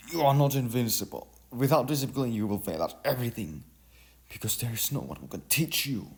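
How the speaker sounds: loud, high scolding of her son; yelling. A narrator's reading. Mother yelling at her son not to be reckless. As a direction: shout it down at him, stern. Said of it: "she yelled at her son".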